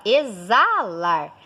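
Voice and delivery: "exalar" said as e-ZAA-lar, stretched; "exalar" with a clear Z; correct Z pronunciation. Shown as a demonstration. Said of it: "ele soa como Z".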